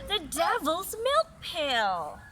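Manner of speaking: loud, high, slow outraged cry; indignant exclamation. Acted. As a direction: exclaim it in scorn, drawing it out. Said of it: "exclaimed the apple branch".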